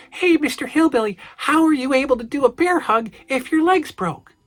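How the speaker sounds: put-on high-pitched little kid voice; small child reading out his letter; eight-year-old self. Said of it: "when little eight-year-old me".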